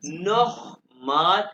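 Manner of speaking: नोखमाल बिटे slow and loud, with a throaty kh in नोखमाल; German ch sound. Shown as a demonstration. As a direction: drag out नोखमाल with a rasping kh. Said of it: "खे की आवाज निकलेगा".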